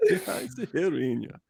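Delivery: high, hammed-up French accent on German; French accent in German, played up. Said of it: "French accent in German".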